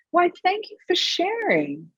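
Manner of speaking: high, sweetly polite thank-you; mock polite thanks. Shown as a demonstration. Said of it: "where I'm just like".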